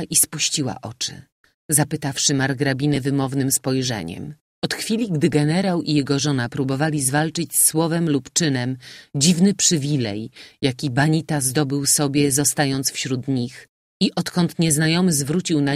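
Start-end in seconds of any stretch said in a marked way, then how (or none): none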